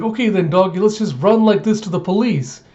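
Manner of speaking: put-on high eager kid voice; bossy little girl. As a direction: order the dog like a kid in charge. Anya giving orders to dog.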